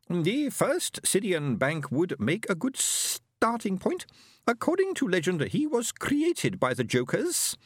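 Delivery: high, slow alien voice; a lofty alien speaking slowly and high; stately high alien voice; alien Fenobe. Acted.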